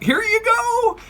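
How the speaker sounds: high squeaky grandma offering vodka; high mock grandma cheer. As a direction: pitch way up like a cheery old grandma. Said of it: "she's like".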